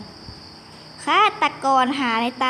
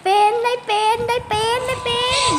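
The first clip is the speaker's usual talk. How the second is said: excited; 9 semitones higher, 3 dB louder than usual. Real, not acted. Cheering she got a turn.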